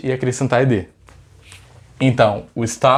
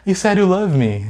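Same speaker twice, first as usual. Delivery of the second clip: higher-pitched acted TV quote; lightly acted female character, higher voice; Monica from Friends; 6 semitones higher than usual. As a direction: play the line from the sitcom scene, pitched up. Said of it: "a Mônica chega e fala pro Chandler".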